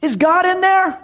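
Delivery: put-on high, loud boy calling from afar; eager kid hollering for attention; boy yelling. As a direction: shout across the fence like a kid. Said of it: "He's like".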